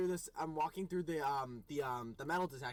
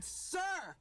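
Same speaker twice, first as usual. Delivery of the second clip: high, far-reaching exclamation of disbelief; retelling shock at the TSA agent, yelped high; exasperated "Sir!". Retold or imitated. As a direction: squeal "Sir!" in outrage. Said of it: "I said".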